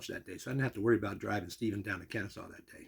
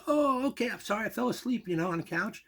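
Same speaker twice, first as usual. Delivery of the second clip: higher, apologetic mimicked voice; sheepish Bob; 11 semitones higher than usual. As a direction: caught napping, sorry. Bob just woken, apologising.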